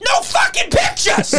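high screaming yell at fans; furious shout. Acted. Doorman screaming at photographers, high furious yell. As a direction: scream them away from the star. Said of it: "I go".

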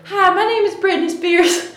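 high, breathy-free southern pop-star greeting. Retold or imitated. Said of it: "I get up on stage and I go".

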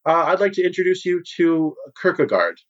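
high, eager host voice, pitched well up; smooth guild boss Moranis. Acted. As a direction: play the slick host showing off a new client. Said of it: "and says".

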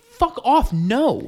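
high, loud fed-up refusal; annoyed brush-off. Retold or imitated. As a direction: snap at a pushy seller. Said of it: "You're like".

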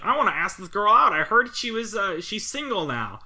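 hint of a hopeful dork; lightly voiced dork. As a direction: lightly act a nerd asking about a crush. Voices the film's nerdy character.

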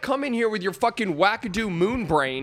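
high-pitched exasperated tirade, easing down at the end; pissed-off rant. Real, not acted. Server venting about the dessert complaint, pissed off. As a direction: vent indignantly at an absent customer. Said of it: "It just pissed me off so much".

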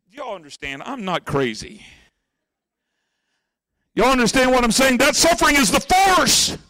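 normal talking voice

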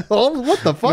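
high-pitched laughing indignation; mock outrage. Podcaster objecting to co-host's crude joke, playfully appalled. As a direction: act scandalized, pitch shooting up.

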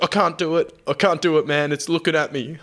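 panicky Tomo. Retold or imitated. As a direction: squeal it out like you're losing your nerve. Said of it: "He's like".

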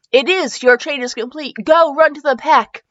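plain reading voice

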